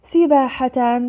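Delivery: سباحة read with a final -tan; tanween fath. Shown as a demonstration. Teacher reading out the word list, tanween fath on سباحة. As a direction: sound out سباحة as "sibaahatan". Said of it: "فتح".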